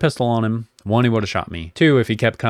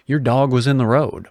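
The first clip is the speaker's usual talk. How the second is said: firm, a bit louder; mildly raised, firm voice; 3 semitones higher, 5 dB louder than usual. Retold or imitated. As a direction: speak up firmly to the angry neighbor. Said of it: "raised my voice a little and said".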